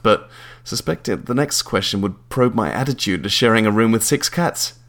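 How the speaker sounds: ordinary delivery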